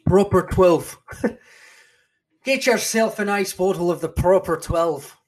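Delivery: loud, high mock Irish brogue with a laugh; bad Irish accent. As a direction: sell the whiskey in a hammed-up Irish accent. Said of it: "probably a horrible Irish accent".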